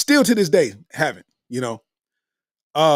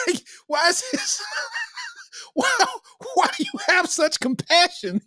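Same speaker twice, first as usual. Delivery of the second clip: much higher, laughing and softer; 8 semitones higher, 3 dB quieter than usual. Real, not acted. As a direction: ask it while cracking up. Laughing mock questions to the drink.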